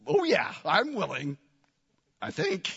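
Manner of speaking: mildly doubtful mock agreement; agree, then trail into doubt; acted half-convinced reply; skeptical. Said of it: "kind of skeptically says".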